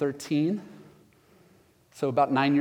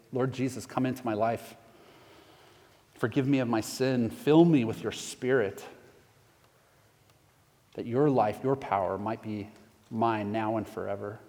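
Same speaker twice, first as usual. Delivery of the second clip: closing prayer; 2 semitones lower than usual. Acted. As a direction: pray it gently, line by line for them to follow.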